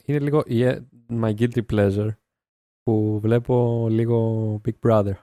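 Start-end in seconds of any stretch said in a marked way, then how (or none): 4.6-5.1 English name in a put-on accent. Real, not acted. Confessing a guilty pleasure to the podcast host, English name accented. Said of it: "Γιατί είπες με προφορά".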